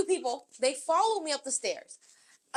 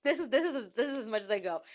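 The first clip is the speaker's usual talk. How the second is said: ordinary delivery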